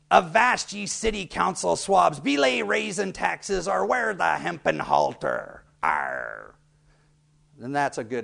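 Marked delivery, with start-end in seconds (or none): 0.1-6.5 slow growling pirate threat. Shown as a demonstration. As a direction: snarl it like an old sea dog. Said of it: "let me try this".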